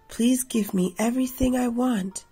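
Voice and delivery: plain reading voice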